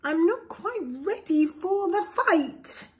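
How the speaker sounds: lively, sing-song storybook read; reading to children. A narrator's reading. Parent reading a picture book to a child, lively storybook voice. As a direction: read it aloud to little ones with relish.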